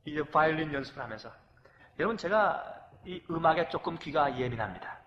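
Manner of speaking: ordinary talk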